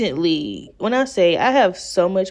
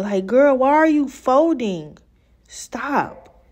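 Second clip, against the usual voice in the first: higher, pleading scold at the wig; exasperated scolding; 6 semitones higher, slower than usual. Real, not acted. Talking to her rolling wig, exasperated scold. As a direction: beg the lace to stop folding.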